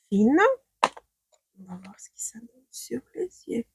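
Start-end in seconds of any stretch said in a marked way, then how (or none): 1.6-3.7 quick hushed whisper. Real, not acted. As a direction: whisper it under your breath. Whispering while applying makeup.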